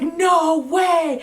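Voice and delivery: high, loud cry of disbelief; retold scream. Mother retelling the twins news, mock screaming. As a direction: re-enact your shocked shriek, drawn out. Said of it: "And I started screaming".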